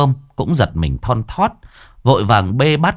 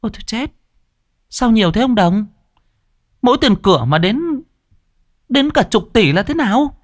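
much higher shocked woman's voice; panicked woman; 13 semitones higher, faster than usual. Acted. Wife shocked by the huge price, high panicked voice. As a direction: gasp it out in alarm at the price. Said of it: "mợ hốt hoảng".

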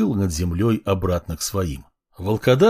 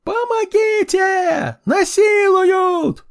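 screaming for help; 3 dB louder, much slower than usual. Acted.

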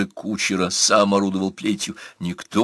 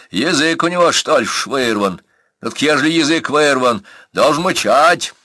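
higher, louder, indignant grumbling; raised voice, impatient reasoning; irritated master; 6 semitones higher, 5 dB louder than usual. Acted. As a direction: carp loudly like a displeased master. Bursa grumbles about the silent victim.

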